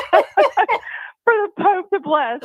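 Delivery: real laugh bubbling through the words; laughing while telling. Real, not acted. Laughing at her own funny story.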